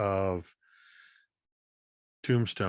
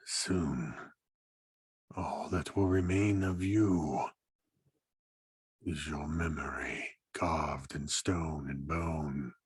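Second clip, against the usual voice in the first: menacing stone creature; 5 semitones lower, 4 dB quieter than usual. Acted. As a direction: promise doom quietly. GM voices the stone figure.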